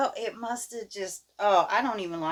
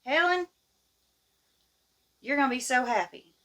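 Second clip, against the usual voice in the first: a hint of a gleeful dad, pitched higher; lightly voiced grinning father; daddy's teasing news; 7 semitones higher, 6 dB louder than usual. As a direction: tease her with the good news in a lightly played dad voice.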